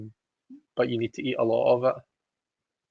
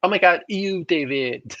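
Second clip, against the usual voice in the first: lightly voiced disgusted Alexis-style ew, drawn out; a playful, disgusted sitcom squeal; Schitt's Creek ew; 4 semitones higher, 3 dB louder, slower than usual. Host imitating a sitcom character's disgusted greeting, light mock voice. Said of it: "a Schitt's Creek reference".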